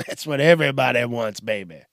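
ordinary talk

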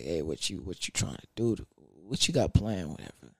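low, soft, smooth voice of a guy making a move. Retold or imitated. Guy asks his shorty about her plans, low and smooth. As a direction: lean in and play it cool.

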